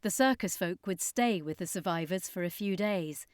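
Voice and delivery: usual speaking voice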